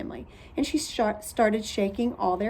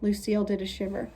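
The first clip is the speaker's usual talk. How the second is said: ordinary delivery, nothing marked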